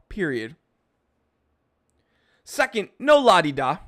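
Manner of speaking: mock furious rant. Vlogger yelling at his commenters in a hammed-up angry rant. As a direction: play a fake-outraged boss laying down the law.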